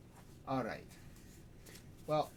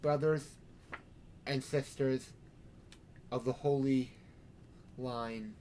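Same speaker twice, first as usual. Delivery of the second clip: sermon; 5 dB quieter than usual. Acted. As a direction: preach it with measured pauses. Sheriff preaching to vampires.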